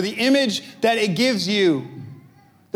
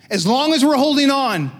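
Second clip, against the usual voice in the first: repeated louder and higher; building emphasis; 4 semitones higher, 4 dB louder than usual. Real, not acted. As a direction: drive the repeat home harder. Repeated with more force.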